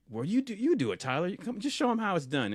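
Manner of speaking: high, eager imitation of a friend; coaxing party guy. Guys pushing Tyler to demonstrate the game, coaxing. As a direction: nudge him to go first, pitched well up.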